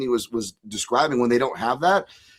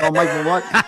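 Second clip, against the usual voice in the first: higher, louder hammed-up Boston accent; 4 semitones higher, 5 dB louder than usual. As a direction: play an excited New England aunt with a thick Boston accent. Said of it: "even I wanna go in a Boston accent".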